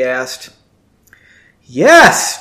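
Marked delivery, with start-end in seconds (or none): 1.6-2.4 bright burst, higher and louder; exclaimed. A narrator's reading. Janie snapping back at the clerk, exclaiming. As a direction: blurt it out eagerly. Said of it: "Janie exclaimed".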